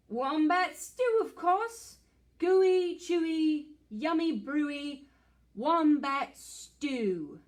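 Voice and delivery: proud dingo. Acted. Dingo boasting to the echidna about his stew, loud and proud. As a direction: relish the recipe like a proud cook. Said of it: "And the dingo says".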